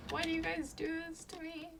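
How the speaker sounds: high, put-upon whine; whiny complaint. Retold or imitated. To teasing friends, a high mock whine. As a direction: plead it like a scared kid. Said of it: "And I'm like".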